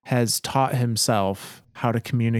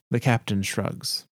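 normal talking voice

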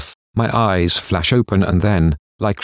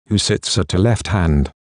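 ordinary delivery, nothing marked

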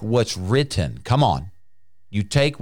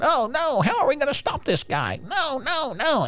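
very high panicked squeal; mock panic in a squeaky, much higher voice; hammed-up frantic wail of demons losing it; freaking out. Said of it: "they're freaking out going".

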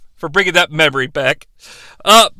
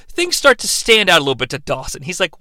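ordinary talk, nothing marked

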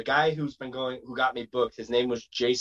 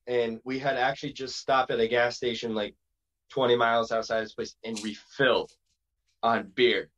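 ordinary talk, nothing marked

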